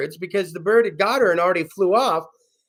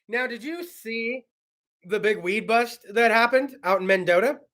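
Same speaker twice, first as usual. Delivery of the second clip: ordinary talk, nothing marked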